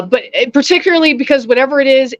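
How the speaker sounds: ordinary delivery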